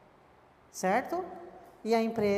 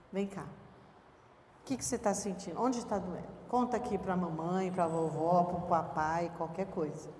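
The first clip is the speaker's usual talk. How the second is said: tender parent; 3 semitones lower, 3 dB quieter than usual. Retold or imitated. Tender parent comforting child.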